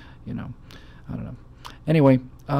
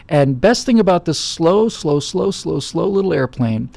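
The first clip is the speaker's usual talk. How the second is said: stretching each slow lazily, slowed down; drawn-out slow; 4 semitones higher, 5 dB louder, slower than usual. Real, not acted. Host dragging out slow to tease listeners about his plane. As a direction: savor the word slow, dragging it out.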